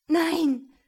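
high, loud burst of protest; blurted refusal. A narrator's reading. Woman shrinks back from man, blurting a refusal. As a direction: let it escape before thinking. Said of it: "entfuhr es ihr".

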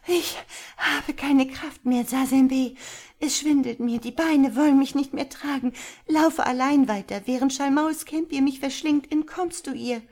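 put-on high, loud despairing girl's voice; desperate exhaustion. A narrator's reading. Exhausted girl begging the boy to run alone, high desperate plea. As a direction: plead desperately at the end of your strength.

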